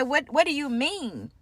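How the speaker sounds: mock the client's outraged disbelief; upset client. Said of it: "she got really upset".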